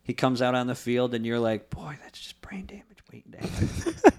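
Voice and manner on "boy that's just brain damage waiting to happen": breathy high whisper of disbelief; whispered aside. Retold or imitated. Fan whispering in disbelief at Brady on the field. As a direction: whisper it like a stunned onlooker. Mocking thought about Brady.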